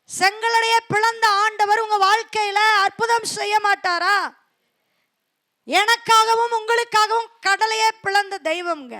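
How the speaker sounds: loud, high, slow climax; preaching at full force. Acted. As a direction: thunder out God's power. (முழு வீச்சில் உரத்த பிரசங்கம்)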